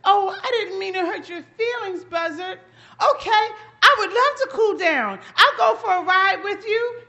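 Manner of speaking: apologetic rabbit. Acted. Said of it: "He said".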